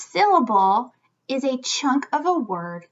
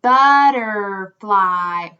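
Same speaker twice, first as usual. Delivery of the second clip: slow, loud, stretched Butterfly; drawn-out syllables; 3 semitones lower, 6 dB louder, much slower than usual. Shown as a demonstration. Teacher feeling the word with children, slow and loud. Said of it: "Let's feel it".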